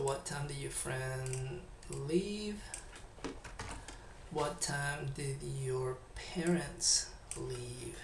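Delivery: ordinary talk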